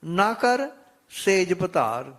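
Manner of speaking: reciting Gurbani with devotion; scripture recitation. Acted. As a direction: intone the verse reverently.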